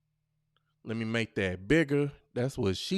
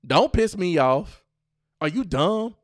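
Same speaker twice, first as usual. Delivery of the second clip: louder scornful jabs; mock angry snap; 6 semitones higher, 7 dB louder than usual. Shown as a demonstration. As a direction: bite these at him. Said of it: "say some of these things".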